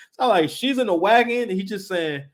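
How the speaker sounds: ordinary delivery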